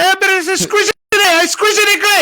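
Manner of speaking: put-on high, hammy Italian accent. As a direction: squeal it in a jokey Italian accent. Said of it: "you'd have to talk in the Italian accent".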